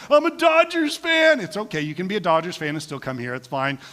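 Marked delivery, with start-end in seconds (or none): 0.1-1.4 higher, louder, slow fan declaration; mock proud fan. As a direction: announce your team proudly. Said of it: "some of you are like".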